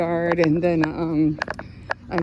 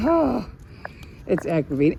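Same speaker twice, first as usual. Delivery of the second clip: ordinary talk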